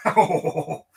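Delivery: talking through a laugh. Real, not acted. Host reacting to a joke that will get heat, laughing. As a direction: let the words out while chuckling.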